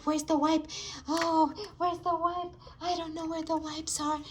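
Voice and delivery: ordinary talk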